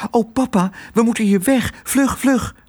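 put-on high, anxious child voice; high-pitched, panicky urging; urgent alarm. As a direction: warn your father to flee at once. Girl urgently warns her father.

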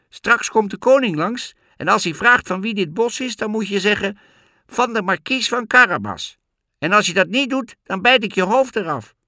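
very high, loud, commanding cat; threatening cat. A narrator's reading. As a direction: order the forester, then threaten him. (zeer hoge, luide, dreigende kat)

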